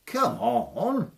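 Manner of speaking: high, rising goading call; sing-song dare at the giant; taunting. Acted. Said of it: "says Tom".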